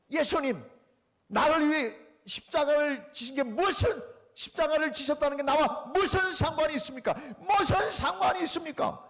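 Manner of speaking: high, loud challenge building to a yell; defiant shout. Retold or imitated. Friend yelling his defiant question to Jesus inside the church, shouting. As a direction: hurl the question at the empty church. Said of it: "냅다 세 번을 소리 질렀는데".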